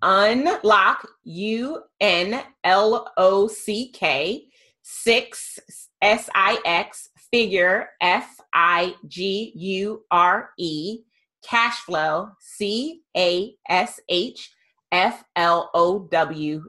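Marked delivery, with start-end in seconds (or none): 1.2-4.5 slow, letter by letter; spelled out. Shown as a demonstration. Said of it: "can you spell that". 6.0-7.1 slow, letter by letter; spelled out. Shown as a demonstration. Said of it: "can you spell that". 8.0-11.0 slow, letter by letter; spelled out. Shown as a demonstration. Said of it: "can you spell that". 12.4-16.6 slow, letter by letter; spelled out. Shown as a demonstration. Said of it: "can you spell that".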